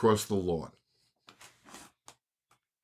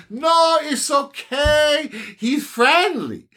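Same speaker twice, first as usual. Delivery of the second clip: slow, much higher imitation of the woman; mocking the woman who says her dogs are friendly, slow and high; drawn-out mock woman; 13 semitones higher, much slower than usual. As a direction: stretch it out, mocking her calm. Woman replies about the dog.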